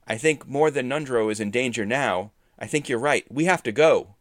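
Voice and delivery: loud, urgent resolve. A narrator's reading. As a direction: press everyone to move out now. Urgent decision amid danger.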